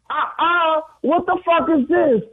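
voz aguda y chillona de clienta furiosa; clienta enojada imitada. Retold or imitated. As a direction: imita a una clienta que reclama su pedido. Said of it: "I'ma do a huevín". (clienta enfadada con voz muy aguda)

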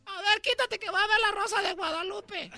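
high, loud pushy mom; mom's voice. Retold or imitated. Mother shooing son to watch her telenovela, high and loud. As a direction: shoo the kid from the TV.

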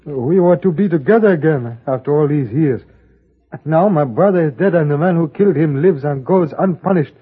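put-on Chinese accent. Chinese character, stereotyped accent.

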